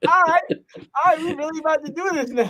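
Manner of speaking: high, excited re-enacted self-hype; giddy, high-pitched celebration; hyped up. Re-enacts hyping self up after success.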